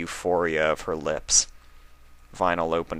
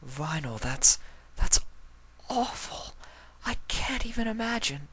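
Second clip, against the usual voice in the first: higher, softer voice for the sweet mare; a soft, higher Octavia, stunned; 11 semitones higher, 6 dB quieter than usual. Acted. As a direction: voice Octavia gently shocked.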